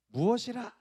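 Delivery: high, loud outburst of royal fury; enraged King Herod. Acted. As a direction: bellow it like an outraged king. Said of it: "화를 막 버럭 내는 거였어요".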